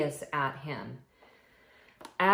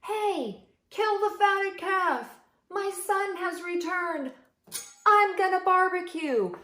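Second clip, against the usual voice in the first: yelling father; 12 semitones higher than usual. Acted. Father yelling to the servants, high and overjoyed. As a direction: boom the order like a thrilled dad. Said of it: "his father yells to the servants".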